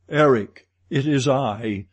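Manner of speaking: loud call to be heard. A narrator's reading. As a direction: project firmly, calling out to him. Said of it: "Then I raised my voice".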